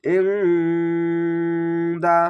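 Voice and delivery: ইনদা with a nasal hum held long before the d; Quranic recitation. Shown as a demonstration. Teacher shows the nasalised ghunna, slow chant. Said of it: "গলা চিপে পড়বেন".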